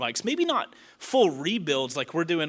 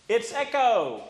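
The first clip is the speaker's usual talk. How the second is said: echoing in an empty room; echoey room; 8 semitones higher, slower than usual. Shown as a demonstration. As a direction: let the room ring around it. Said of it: "It's so echoey in here".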